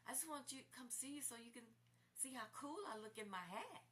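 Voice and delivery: playful mock of his pride; proud boyfriend. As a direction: brag about the hat. Quoting his proud boast.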